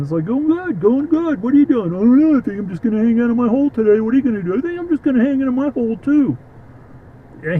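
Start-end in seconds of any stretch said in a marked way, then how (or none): 0.3-1.9 very high, squeaky critter voice; cartoon groundhog. Groundhog answers neighbour. 1.9-4.6 high, quick and louder critter chatter; lazy groundhog. Acted. Groundhog replies about its day. 4.6-6.4 very high, squeaky critter voice; cartoon groundhog. Other groundhog agrees.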